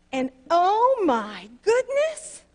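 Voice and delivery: slow, high and loud exclaim; building burst of feeling; emphatic exclamation. Real, not acted. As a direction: stretch out oh my goodness in awe. Climax of story.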